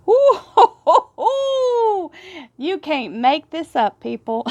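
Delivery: high, loud squealing "Ooh" breaking into laughter; can't contain the thrill, shriek then laugh; excited squeal and laugh. Real, not acted. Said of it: "My little Texas Southern drawl jumped out on that".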